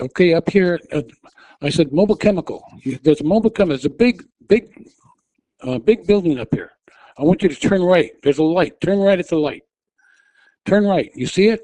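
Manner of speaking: high, loud, pressing directions; urgent yelling. Retold or imitated. Woman yelling landmarks at diabetic driver, high urgent shout. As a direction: shout each landmark to keep him steering.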